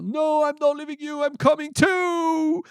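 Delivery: high, loud heroic yell; high-pitched desperate shout; Godric. Acted. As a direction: yell it out, drawing out the end. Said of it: "Godric yell".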